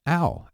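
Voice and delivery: "Ow" as in cow; teacher demo. Shown as a demonstration. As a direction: glide "Ow" like ow in cow. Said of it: "as in the word cow".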